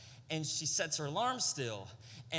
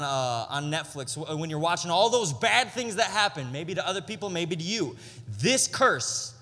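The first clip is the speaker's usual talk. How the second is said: louder, driving preaching; building sermon; 7 dB louder than usual. Acted. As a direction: press the point home with weight. Preaching at full force.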